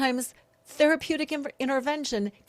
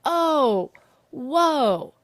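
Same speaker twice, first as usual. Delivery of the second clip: a put-on, stunned oh whoa; mock amazement; 4 semitones higher, 6 dB louder than usual. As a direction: act out being floored. Mimicking a stunned reaction.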